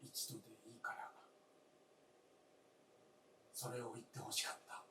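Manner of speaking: low, soft, quick whisper; whispering low and fast, wistful plea to his wife. Acted. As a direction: breathe the longing out low. Old man's wistful plea.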